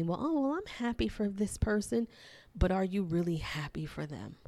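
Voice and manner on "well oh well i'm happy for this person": much higher, sing-song put-on delight; mock fake cheer. Mocking people who claim they're happy for others, in a hollow sing-song. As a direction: overplay the cheer so it rings hollow. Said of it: "other people may say".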